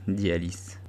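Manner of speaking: plain reading voice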